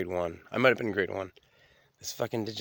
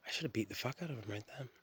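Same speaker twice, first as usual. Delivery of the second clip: ordinary delivery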